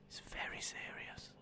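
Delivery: hushed, voiceless mock threat; menacing whisper. Streamer warning chat about blocking, ominous whisper. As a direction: repeat it in a barely audible whisper.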